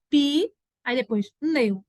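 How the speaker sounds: "pi" held, pause, then "neu" apart; syllable split. Shown as a demonstration. Teacher showing why the p seems separate, split syllables. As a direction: sound out "pi" and "neu" as two pieces. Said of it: "mesmo tendo um sonzinho com I".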